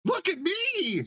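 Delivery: squeaky high gnome voice, shrieking with glee; manic gnome. Acted. Gnome showing off to the space pirate, squeaky and manic. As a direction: pitch way up like a giddy little gnome.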